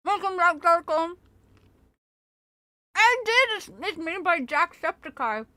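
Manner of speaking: talking with mouth full; chewing while talking; messy loud eating. Real, not acted. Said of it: "I'm sorry for talking with my mouth full".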